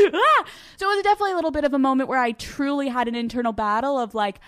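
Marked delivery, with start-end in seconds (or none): 0.1-0.4 high burst of laughter; loud laughing cry. Real, not acted. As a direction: laugh and shriek.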